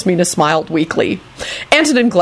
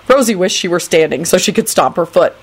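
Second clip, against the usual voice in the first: ordinary delivery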